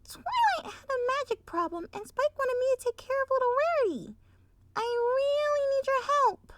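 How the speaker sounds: high, urgent little filly. Acted. Sweetie Belle begging her parents, high and urgent. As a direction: plead like a small child.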